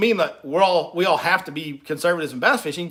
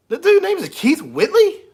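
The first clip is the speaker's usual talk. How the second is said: 1 mock disbelief; 12 semitones higher, 5 dB louder than usual. Real, not acted.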